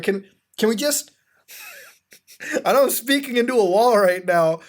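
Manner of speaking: loud and high, laughing through it; laughing exasperation. Real, not acted. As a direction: laugh at the hopelessness. Laughing at his own pointless plea.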